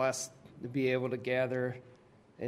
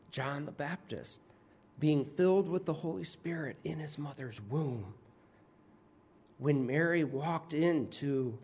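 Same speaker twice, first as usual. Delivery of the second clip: ordinary delivery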